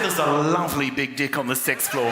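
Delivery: lightly voiced Kiwi receptionist; a hint of a Kiwi accent saying dick. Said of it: "She said".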